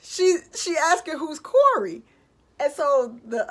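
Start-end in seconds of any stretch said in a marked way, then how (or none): 0.0-2.0 loud, high incredulous cop; amused cop. Retold or imitated. Cop telling partner she asks who Corey is. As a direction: call to your partner in disbelief. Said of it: "he's like".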